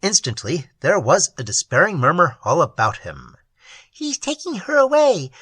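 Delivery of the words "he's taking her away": high, tiny troll voice pleading; a small creature telling its woes. A narrator's reading. Troll explaining its loneliness to Prindle, high and small.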